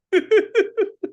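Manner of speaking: high burst of laughter. Real, not acted. Preacher laughs at his own hospital story, amused. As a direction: laugh out at the punchline.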